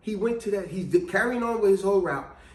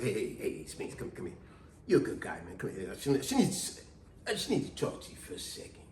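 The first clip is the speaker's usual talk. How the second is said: hushed, conspiratorial whisper; an old coworker whispering by the time clock; secretive whisper; 10 semitones lower, 11 dB quieter than usual. Retold or imitated. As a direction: draw him close and keep it secret. Old Nick pulls him aside secretly.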